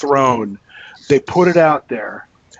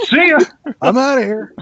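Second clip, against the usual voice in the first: mock breezy exit; 10 semitones higher, 3 dB louder than usual. Leaving quickly, mock breezy.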